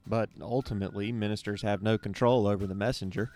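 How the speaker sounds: calm, measured voiceover over music; podcast intro read. Acted. Host introduces the Naked Preacher Podcast, calm voiceover read. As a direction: read the scripted opener like a host narrator.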